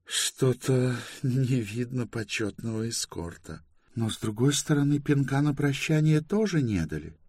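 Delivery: high-pitched woman's wry quip; forced humour. A narrator's reading. Bayta joking after their release, forced humour. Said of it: "с вымученным юмором проговорила".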